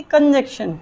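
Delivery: "कंजक्शन" as kan-JEK-shan, no N in the middle; students' mispronunciation. Shown as a demonstration. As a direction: turn "कंजक्शन" into kan-jekshan. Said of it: "इसको बोलता है विद्यार्थी". ("कंजक्शन" को कन-जेक्शन, गलत उच्चारण)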